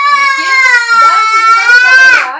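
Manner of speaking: loud, high, laughing words; laughing through words. Real, not acted. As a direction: talk while bursting into laughter. Laughing while talking. (bicara keras sambil tertawa)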